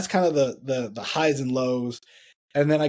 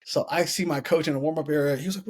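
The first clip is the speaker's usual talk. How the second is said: usual speaking voice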